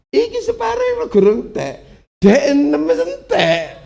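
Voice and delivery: very high, loud cry of disbelief; astonished squeal. Retold or imitated. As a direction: squeal it out in shock. (suara kaget melengking tinggi)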